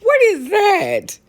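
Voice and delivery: high, slow disbelieving cry through laughter; incredulous. Retold or imitated. Comic to the audience about jerky, incredulous. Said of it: "I'm like".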